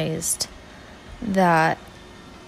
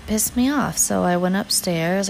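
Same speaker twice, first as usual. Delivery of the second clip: ordinary talk, nothing marked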